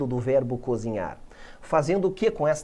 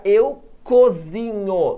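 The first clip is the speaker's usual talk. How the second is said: very slow eu cozinho, each word drawn out; slow teaching read; 2 semitones higher, 5 dB louder, much slower than usual. Shown as a demonstration. Teacher slowly reads the translation for students. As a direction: spell it out slowly for the class. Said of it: "slow demo translation".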